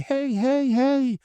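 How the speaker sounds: high-pitched mock call after someone; imitated calling out. She runs out waving at him, imitated high call.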